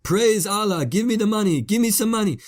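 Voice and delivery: high-pitched imitation of frantic begging; mock screaming plea. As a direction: act out a desperate man screaming for money. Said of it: "just screaming about Allah".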